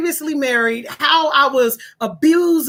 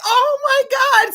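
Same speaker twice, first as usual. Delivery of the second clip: tearful outburst; 10 semitones higher, slower than usual. Real, not acted. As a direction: cry it out overwhelmed. Overcome recalling the introduction.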